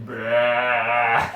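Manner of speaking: imitated deer bleat, loud and nasal. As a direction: blurt out a deer's "bah" sound. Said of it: "I heard it going".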